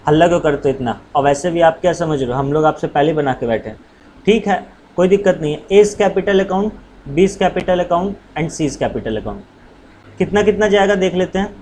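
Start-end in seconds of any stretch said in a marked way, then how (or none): 5.7-10.2 slow dictation. Shown as a demonstration. Teacher dictating entry to class.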